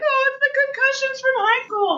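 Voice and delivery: squeaky high, playing innocent; sudden high pitch, all innocence; high innocent excuse. Excuse voiced in imagined scene.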